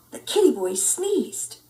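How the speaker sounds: plain reading voice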